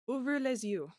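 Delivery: halting, careful French from an English speaker; broken French. Acted. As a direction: sound out the French like a phrasebook tourist. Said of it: "apologies in advance for my broken French".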